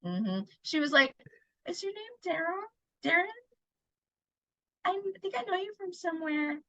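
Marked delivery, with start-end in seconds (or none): 1.7-6.6 soft, hesitant quote with pauses; imitated hushed, uncertain recognition; soft acted questioning. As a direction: quote her quietly and tentatively, pitched up. Acting out her quiet recognition of him.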